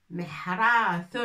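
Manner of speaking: محراث said slowly, each sound clear, ending falling; Arabic word said slowly. Shown as a demonstration. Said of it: "المحراث أو محراث". (محراث articulé lentement, chaque son bien net)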